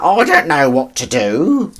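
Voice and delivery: a hint of high camp fluster; light mock camp panic. The speaker doing his own camp impression, lightly voiced fluster. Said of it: "and I was like".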